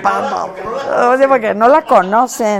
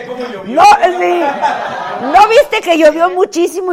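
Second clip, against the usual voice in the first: outraged shout; 8 semitones higher, 8 dB louder, slower than usual. Real, not acted. Friend dodges, mock outrage erupts.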